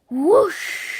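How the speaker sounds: loud breathy whoosh sound. A narrator's reading. Narrator sounding the big candle puff. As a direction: blow it out like candles.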